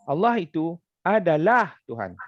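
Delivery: emphatic adalah before Tuhan; stress on adalah. Shown as a demonstration. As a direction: break after itu and lean on adalah. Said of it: "dimulakan dengan perkataan adalah".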